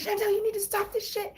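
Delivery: high mock-nagging friend voice; imitating a friend. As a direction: play the fed-up best friend telling her to stop. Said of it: "but she's like".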